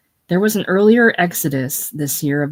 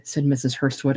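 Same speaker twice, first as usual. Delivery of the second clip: ordinary delivery, nothing marked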